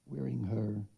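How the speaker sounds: ordinary talk, nothing marked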